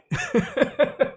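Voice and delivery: a high laugh breaking out after the bit. Real, not acted. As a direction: laugh off the silly voice.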